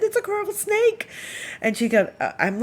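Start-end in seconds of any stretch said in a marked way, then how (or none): 0.0-1.1 panicked snake alarm. Retold or imitated. Telling friend about deadly snake.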